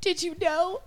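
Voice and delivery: light mom voice with a lift; hint of mom; imitating mom. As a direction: read it like mom. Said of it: "I'm gonna do it as best I can in my mom's world".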